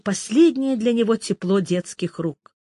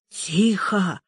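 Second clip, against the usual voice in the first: hoarse croak. Acted. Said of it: "прохрипел солдат".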